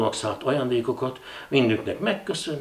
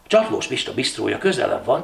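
usual speaking voice

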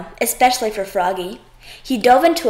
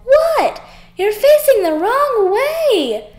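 alarmed; 12 semitones higher, 5 dB louder, slower than usual. Acted. Dad alarmed at Froggy.

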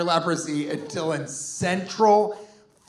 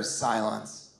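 ordinary delivery

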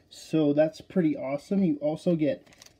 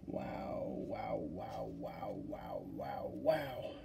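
awed whisper; 5 semitones lower, 13 dB quieter, much slower than usual. Real, not acted. Awed at the card.

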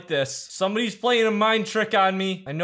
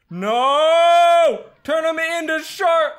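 panicked yell; 7 semitones higher, 8 dB louder, much slower than usual. Real, not acted. Panicking as monster attacks.

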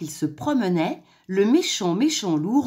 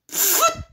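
sudden high spitting sound; spitting noise; 3 dB louder than usual. A narrator's reading. Wolf spitting out stone soup, high spitting noise. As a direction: spit out a nasty mouthful. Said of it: "cracha-t-il".

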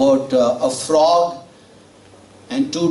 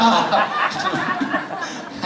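laughing; 10 semitones higher, 4 dB quieter than usual. Real, not acted. Preacher laughs at own story.

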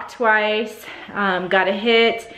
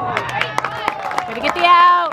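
yelling from the sidelines; 9 semitones higher, much slower than usual. Real, not acted. Cheering players from the stands.

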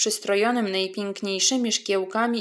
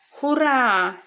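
cheering shout; 4 semitones higher, 4 dB louder than usual. Acted. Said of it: "zakrzyknęła zawstydzona polana".

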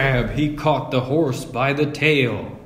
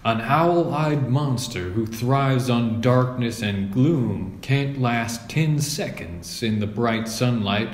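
ordinary delivery

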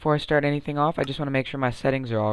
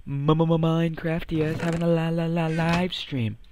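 stuttered hype; 2 semitones higher than usual. Pretend. Announcing tomorrow's live stream, stuttered hype. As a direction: hype it like a DJ echo.

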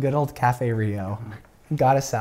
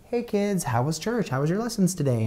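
imitated mom voice, higher and gentle; 6 semitones higher than usual. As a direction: play a mom sweetly checking in with the kids.

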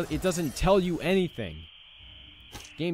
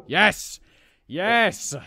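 loud, high cheer of relief; triumphant yes; 5 semitones higher, 8 dB louder than usual. Real, not acted. Player finally beating the level, loud triumphant cheer. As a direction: celebrate the win.

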